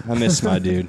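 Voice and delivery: normal talking voice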